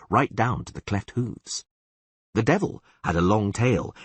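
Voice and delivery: ordinary delivery, nothing marked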